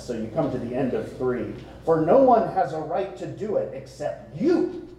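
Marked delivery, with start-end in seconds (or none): 1.8-4.9 YOU lifted high at the end, exclaimed; forceful line landing hard on "you"; emphatic exclamation. Acted. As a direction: punch the opening, then throw "you" up like an exclamation point. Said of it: "There should be an exclamation point there".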